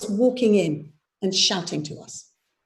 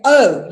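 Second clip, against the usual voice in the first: "Oh" hit hard and loud; stressed Oh; 9 dB louder than usual. Shown as a demonstration. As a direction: punch the "Oh". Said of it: "you're hitting the O".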